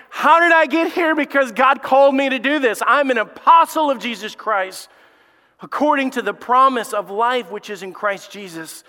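loud, high preaching build; full-force preaching. Real, not acted. Preacher rising to the church, loud and high.

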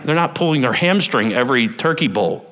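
ordinary talk, nothing marked

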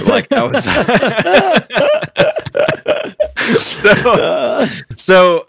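high squealing laugh. Real, not acted. Dad laughing at his own rage over the bowl. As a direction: burst into helpless high laughter. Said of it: "liquid fire just came out of my eyeballs".

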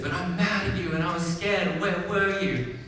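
high-pitched upset little rabbit; letting emotion out. Retold or imitated. Little rabbit lets big rabbit hear it, acted upset. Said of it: "let all the emotion out".

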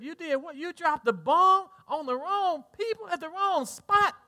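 high-pitched, incredulous imitation; acted disbelief. People back home hearing of friendly fire, acted disbelief.

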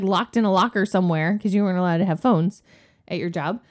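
ordinary talk, nothing marked

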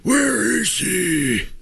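slow drawn-out Batman growl. Pretend. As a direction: drag it out like Batman. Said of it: "That's my Batman impression".

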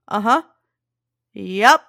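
short dismissive answers, then a laugh; curt brush-off. Retold or imitated. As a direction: end the chat fast and leave. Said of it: "I go".

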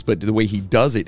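ordinary delivery

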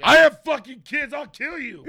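high furious yell at a creep; berserk rage. Acted. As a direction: scream a threat to protect the kids. Mock threatening parent.

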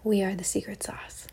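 soft whispered punchline; whispering. Real, not acted. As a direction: breathe it like a secret. Whispered punchline after laugh.

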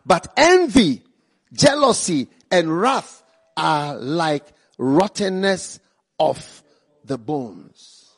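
loud, rising preacher's warning; preaching at full force. Acted. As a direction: thunder it at the congregation.

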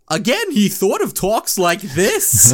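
high, slow, loud put-on cartoon dad voice; imitating the cartoon alien dad. Shown as a demonstration. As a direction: do the alien dad's odd cartoon voice again. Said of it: "Listen to the dad and how he talks".

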